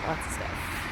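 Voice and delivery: hushed repeat under breath; whispering. Real, not acted. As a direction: whisper it to yourself.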